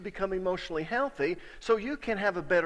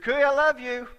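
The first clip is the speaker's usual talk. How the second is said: louder, higher I love you; earnest declaration; 6 semitones higher, 9 dB louder than usual. Retold or imitated. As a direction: say it boldly again. Repeat declaration to father-in-law.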